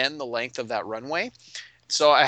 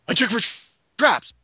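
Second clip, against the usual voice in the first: high, over-the-top announcement; showy trap-checker; 8 semitones higher, 5 dB louder than usual. Retold or imitated. Player announcing his usual trap check, showy. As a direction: declare it with exaggerated, high-pitched urgency. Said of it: "made a huge show about".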